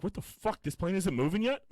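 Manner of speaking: high, startled outburst; jolted awake and alarmed; shocked. Retold or imitated. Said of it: "He's like".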